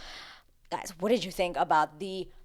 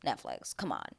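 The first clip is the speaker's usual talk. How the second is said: low, soft exasperation at Netflix; low fed-up aside; exasperated groan; 11 semitones lower, 10 dB quieter than usual. Retold or imitated. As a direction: mutter it, fed up. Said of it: "I'm like".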